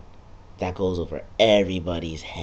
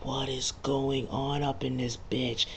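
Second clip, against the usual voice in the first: baffled mumble; 4 semitones higher, 9 dB quieter than usual. Retold or imitated. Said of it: "and you're just like".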